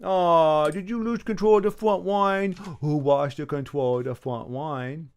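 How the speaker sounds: put-on taunting baby voice with w for r and l; mock baby talk. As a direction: tease as if talking to a baby. Taunting the game opponent, baby talk.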